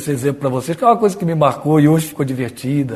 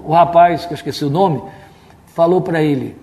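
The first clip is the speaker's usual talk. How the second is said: ordinary talk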